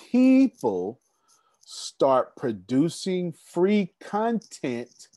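very slow, stressed phrase; slow emphasis. Real, not acted. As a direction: drag it out to make the point. Stressing the key point.

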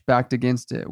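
plain reading voice

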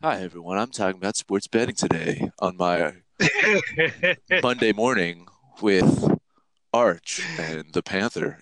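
lightly done calm NPR presenter; NPR host voice. Shown as a demonstration. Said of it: "I turn it to N P R Max".

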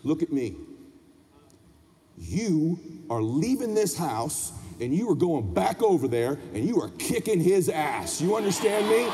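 low, deliberate dad giving orders; stern father. Retold or imitated. Dad down at son's level, stern command. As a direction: lay down the law, low and deliberate.

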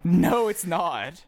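loud, high, drawn-out objection; exasperated protest. Real, not acted. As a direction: object loudly, stretching every word. Objects to being misrepresented.

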